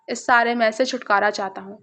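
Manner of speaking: ordinary delivery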